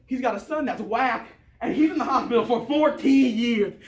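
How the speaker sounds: ordinary talk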